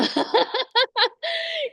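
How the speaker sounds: bright burst of high laughter. Real, not acted. Laughing about metal screaming.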